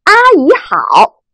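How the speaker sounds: 阿姨好 with high level 阿, drawn slowly; first tone greeting. Shown as a demonstration. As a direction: hold 阿 high and flat, slowly. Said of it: "第一声". (阿姨好，第一声，高平慢读)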